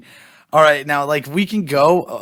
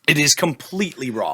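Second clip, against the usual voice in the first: eating sour candy. Real, not acted. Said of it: "my tongue is completely raw".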